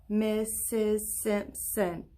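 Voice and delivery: "Missus Simpson" slowly in four separate beats; syllable by syllable. Shown as a demonstration. Teacher counting her name's syllables, "Missus Simpson" slow and separated. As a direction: chop "Missus Simpson" into four drawn-out, even parts. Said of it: "You wanna try it with me?".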